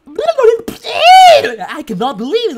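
very high, loud alien squeal; shocked alien. Acted. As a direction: squeak it out in hammed-up disbelief. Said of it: "there's two aliens".

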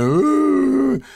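long high-pitched fake sob; drawn-out imitated boo-hoo wail; exaggerated crying howl; mock crying wail. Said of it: "she starts crying".

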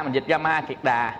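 ordinary talk, nothing marked

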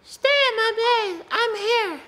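high, slow eerie toy voice; creepy toy voice. Retold or imitated. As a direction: copy the toy's creepy high words. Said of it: "It literally said".

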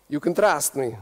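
lightly put-on Italian English; a hint of a shady Italian; Italian accent. Shown as a demonstration. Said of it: "you already know what it sounds like".

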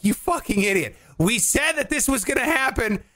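high, rising exasperated yell; mock angry crowd. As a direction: scold him like a furious fan who saw it coming. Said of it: "They're like".

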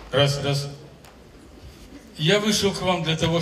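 normal talking voice

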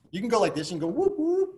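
ordinary talk

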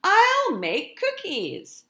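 put-on a kid cat with a bright idea; excited Pete. Pete the cat's thought.

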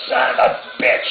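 loud, rough curse; angry yell. A narrator's reading. Santiago as the Lexus runs over his boot, angry yell. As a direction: yell it out in sudden pain. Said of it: "he yelled".

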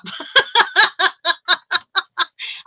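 long, high cackling laughter; helpless laugh. Real, not acted. Streamer tickled by Lex's bap message, helpless laugh. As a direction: crack up completely.

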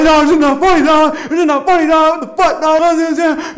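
high, loud furious barking; re-enacted indignant ranting at full volume. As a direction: yell it until the words blur. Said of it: "I'm just barking at this point".

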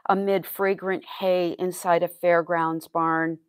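measured verse with pauses between phrases; poet's careful, projected recital; poetry reading. Acted. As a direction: recite it line by line, evenly paced. Poet reads second poem aloud.

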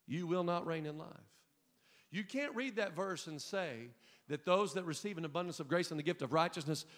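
ordinary talk, nothing marked

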